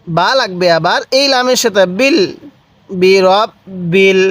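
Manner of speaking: usual speaking voice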